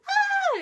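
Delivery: very high, loud mock shriek; piercing high imitated scream; fangirl scream. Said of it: "They're like".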